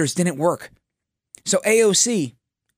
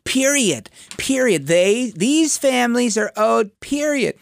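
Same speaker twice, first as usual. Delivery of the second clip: high, slow mock of her emphatic period; mocking AOC impression; 6 semitones higher, slower than usual. Host mocking AOC's reparations line, high mock voice. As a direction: draw out each period up high. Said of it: "I am doing my impression of her".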